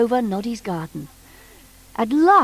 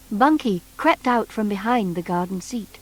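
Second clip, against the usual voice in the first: ordinary delivery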